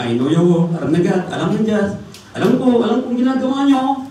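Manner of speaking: high, loud stern warning; voice of God warning. Retold or imitated. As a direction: speak as God scolding the church. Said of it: "sabi niya". (babala ng Diyos, mataas at malakas)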